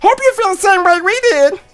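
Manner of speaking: high, put-on cartoon voice; Meatwad impression. Player voices homeboy recalling choosing a Pokemon, high Meatwad voice. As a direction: keep it high and loud.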